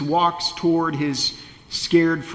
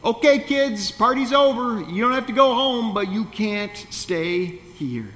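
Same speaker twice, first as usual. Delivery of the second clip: lightly voiced, breezy dismissal, pitched higher; Jesus lightly sending the crowd home, higher and brighter; Jesus shooing everyone off; 6 semitones higher than usual. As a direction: shoo the crowd off, playful but firm. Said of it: "send his friends or the crowds away saying".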